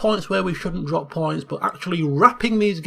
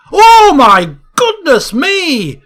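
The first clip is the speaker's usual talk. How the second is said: ecstatic goal call; 9 semitones higher, 9 dB louder than usual. Real, not acted. Commentator screaming about a twenty-five yard screamer, ecstatic. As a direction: lose it completely at a stunning goal.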